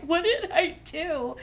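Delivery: high, slow, pleading cry; imitating a sob, pitched high and drawn out; retold sobbing. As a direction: plead through tears, slowly. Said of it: "I'm just sobbing".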